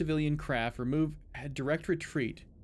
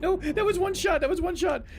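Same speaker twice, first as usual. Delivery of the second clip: laughing disbelief; 4 dB louder than usual. Real, not acted.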